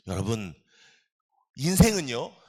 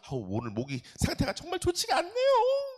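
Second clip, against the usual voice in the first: mock complaint. Mocking a preacher's excuse, laughing.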